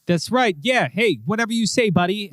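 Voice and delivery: lightly voiced cop humouring him; placating cop. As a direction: back off while agreeing.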